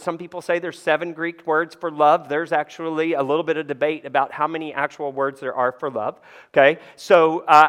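normal talking voice

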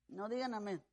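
ordinary talk

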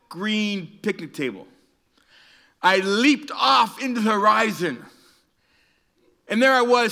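loud, high, drawn-out mock-heroic telling; epic storytelling. Preacher telling Eli of his doomed leap, mock-epic. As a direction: build the leap up like a grand feat.

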